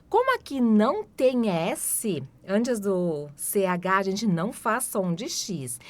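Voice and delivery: usual speaking voice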